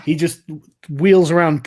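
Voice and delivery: normal talking voice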